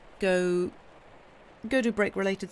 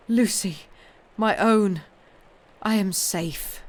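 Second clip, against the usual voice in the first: loud and moved, easing to slow relief; emotional reunion; 3 semitones higher, 6 dB louder than usual. Acted. Husband returns home safe.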